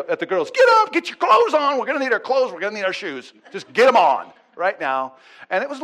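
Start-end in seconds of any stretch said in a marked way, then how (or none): 0.5-5.1 put-on high, loud panicked orders to the kids; mother yelling at her daughters to dress fast; frantic yelling. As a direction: yell at the girls to hurry. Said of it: "Christy's screaming at the ki".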